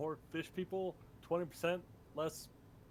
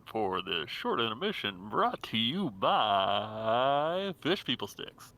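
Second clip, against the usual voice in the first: ad announcer voice; 5 semitones lower, 10 dB louder, slower than usual. Pretend. Mock sponsor announcement.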